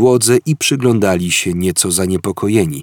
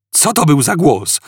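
a somewhat higher, mild mock roar; 6 semitones higher than usual. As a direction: call out over the din, lightly. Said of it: "ryknął Artur".

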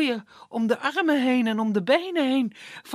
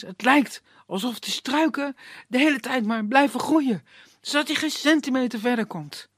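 plain reading voice

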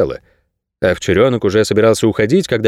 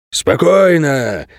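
slow, raised-pitch command, only mildly thundering; commanding bark; 6 semitones higher, much slower than usual. A narrator's reading. Lenny calls the bristling dogs to order, mildly barked. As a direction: stretch out the order with authority, pitched up. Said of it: "громоподобным голосом рявкнул Ленни".

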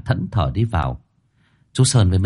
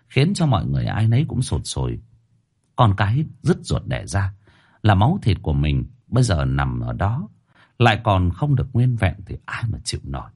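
usual speaking voice

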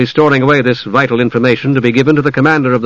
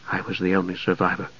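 much softer, lower, barely audible; hushed, hurried admission; very quiet; 5 semitones lower, 11 dB quieter, faster than usual. A narrator's reading. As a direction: say it so quietly one must lean in. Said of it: "He spoke so quietly".

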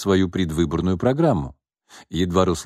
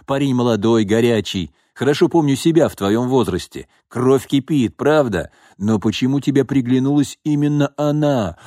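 higher, knowing, teasing father; sly old father voice; 5 semitones higher, 3 dB louder than usual. Acted. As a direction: tease him like a worldly dad.

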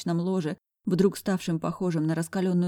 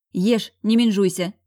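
brisk mock bark, louder than usual; firm, slightly snappy command; barked order; 4 semitones higher, 7 dB louder than usual. A narrator's reading. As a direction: order a friend about gruffly. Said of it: "рявкнула зайка".

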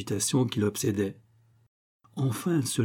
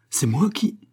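mildly indignant, higher rising question; 5 semitones higher than usual. A narrator's reading. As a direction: protest with a touch of disbelief. Husband protests wife's accusation.

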